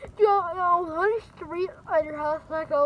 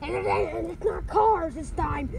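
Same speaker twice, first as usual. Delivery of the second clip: ordinary talk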